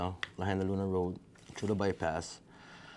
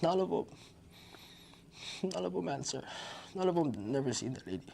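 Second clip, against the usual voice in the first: exasperated; 8 semitones higher, 5 dB quieter than usual. Real, not acted. Frustrated, panicked search.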